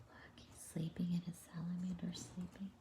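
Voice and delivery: soft hushed whisper; gentle whisper over a sleeping picture. Real, not acted. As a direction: whisper so you don't wake them.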